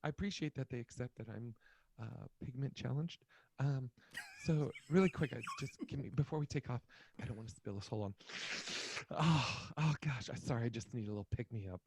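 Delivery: soft, hushed put-on girlfriend voice; girlfriend role. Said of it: "I'm the girl, all right?".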